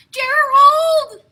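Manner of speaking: high, loud cry of a name; calling Gerald. Acted. Piggy calling out to her friend Gerald, high and loud. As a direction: shout for your friend at the top of your voice.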